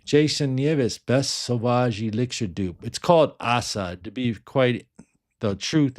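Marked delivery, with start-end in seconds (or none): none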